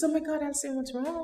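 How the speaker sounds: startled concern for a crying colleague; concerned surprise. Retold or imitated. Boss sees her crying and asks, mildly alarmed.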